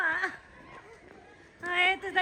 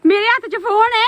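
loud, urgent protest; 8 dB louder than usual. Real, not acted. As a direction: call it out loud to stop them. Shouting to stop colour.

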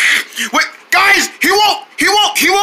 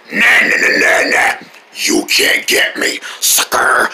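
taunting sing-song; 8 semitones lower than usual. Acted. Taunting the chasers.

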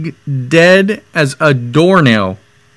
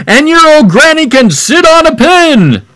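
very high, loud taunting shout; taunting yell; 13 semitones higher, 5 dB louder than usual. Retold or imitated. Third pig yelling an insult about granny, very high and loud. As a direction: squeal the insult at him. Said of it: "Then the third little pig yelled".

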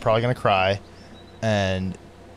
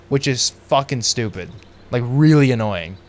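normal talking voice

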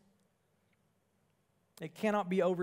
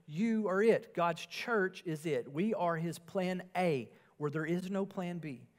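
ordinary talk, nothing marked

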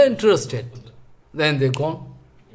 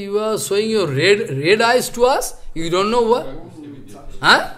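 usual speaking voice

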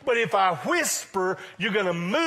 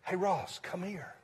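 hushed near-whisper; 8 dB quieter than usual. Shown as a demonstration. Preacher playing God drawing Ross closer, hushed. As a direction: call him over under your breath. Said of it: "He whispers".

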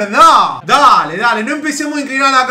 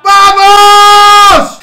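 goal scream; 8 semitones higher, 13 dB louder than usual. Real, not acted.

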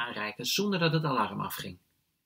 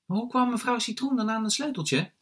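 put-on high, puzzled child's question; child asking; 10 semitones higher than usual. A narrator's reading. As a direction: ask it high, as a curious child. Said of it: "vraagt Charlie".

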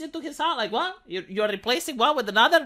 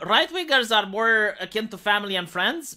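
usual speaking voice

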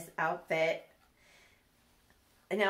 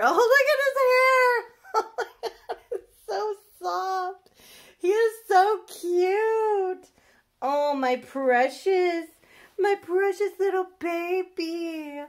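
squealing coo; 11 semitones higher, 8 dB louder, slower than usual. Real, not acted. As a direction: gush at him with an adoring squeal. Gushing over the baby's hair.

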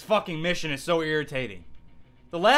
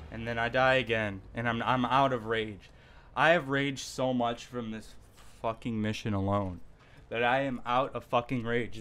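drained and defeated; 7 semitones lower, 8 dB quieter than usual. Real, not acted. Drained of rage.

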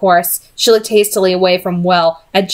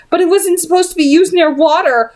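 higher, quivering plea; a high, quivering protest; 9 semitones higher than usual. Acted. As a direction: defend Henry with a quaver. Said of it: "still in the same quivering voice".

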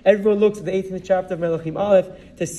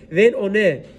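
usual speaking voice

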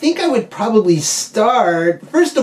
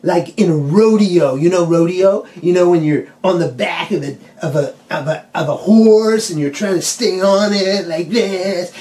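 normal talking voice

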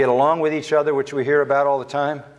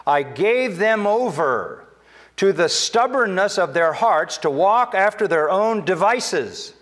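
higher, slow, pleading pulpit voice; drawn-out, higher preaching of Scripture; preaching God's words; 7 semitones higher, 3 dB louder, slower than usual. Acted. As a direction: lift it up and plead as God to his people. Quoting God in Scripture.

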